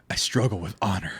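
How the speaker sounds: lightly voiced anime hero, low and soft; a hint of brooding anime hero; anime character. Shown as a demonstration. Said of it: "read it like an anime character".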